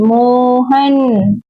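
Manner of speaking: मोहन drawn out slowly, ending in a fall; calling out. Shown as a demonstration. Teacher calls मोहन again, slowly drawn out. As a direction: stretch मोहन long as if calling someone. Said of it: "ये देखिए".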